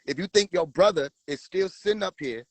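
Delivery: normal talking voice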